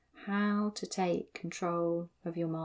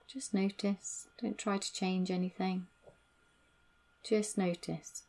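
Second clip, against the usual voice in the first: calm grounding exercise. Acted. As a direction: lead them calmly back into the body. Guides body awareness.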